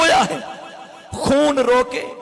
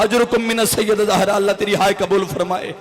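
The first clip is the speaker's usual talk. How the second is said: ordinary delivery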